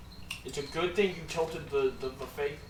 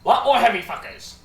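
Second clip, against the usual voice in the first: loud npc; 7 semitones higher, 15 dB louder than usual. Acted. NPC warning players.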